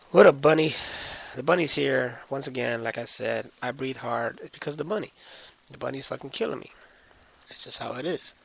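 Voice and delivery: ordinary delivery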